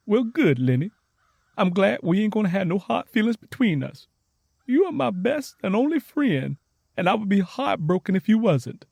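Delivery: high, warm happy relief; relieved and glad. Acted. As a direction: reassure her best friend. Tangy relieved.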